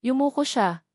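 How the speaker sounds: ordinary delivery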